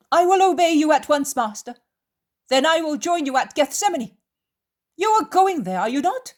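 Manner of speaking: high, loud character voice; reply loudly, pitch swooping high; loud high voice rising into questions; voiced Judas. Narrator voices Judas replying to Jesus.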